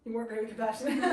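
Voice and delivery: ordinary talk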